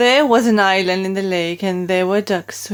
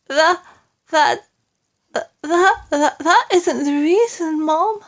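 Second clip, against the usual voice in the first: stammering and frightened, higher; quivering stammer; 8 semitones higher than usual. A narrator's reading. Maid too frightened to tell the Queen, quivering stammer. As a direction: stumble over the words, scared. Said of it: "voice was quivering terribly".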